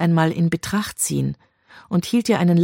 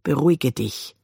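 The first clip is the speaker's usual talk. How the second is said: cold; 2 semitones lower than usual. A narrator's reading. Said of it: "sagte er kalt".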